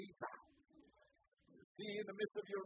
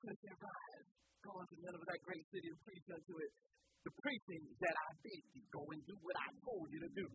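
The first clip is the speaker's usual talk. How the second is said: soft, hushed, half-whispered command; 4 semitones lower, 8 dB quieter than usual. Acted. Preacher repeating God's words to the congregation, hushed. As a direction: drop to a near whisper.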